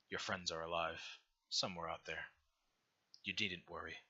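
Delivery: low, soft, unhurried divine voice; a gentle deity offering a choice, calm and low; benevolent god. Acted. God offering the dying hero a choice, low and soft. GM god's final line.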